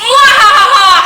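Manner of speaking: slow shouted mwahaha; evil villain laugh. Acted. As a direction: cackle like a cartoon villain.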